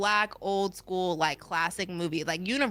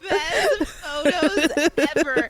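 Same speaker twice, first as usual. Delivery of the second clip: laughing; 8 semitones higher, 4 dB louder, much slower than usual. Real, not acted. Laughing over the funny photos.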